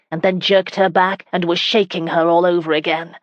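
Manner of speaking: ordinary delivery, nothing marked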